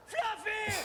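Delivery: high, loud crowd-style shout; excited yell. Retold or imitated. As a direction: yell it out like thrilled fans spotting a star. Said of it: "all jumped up and yelled".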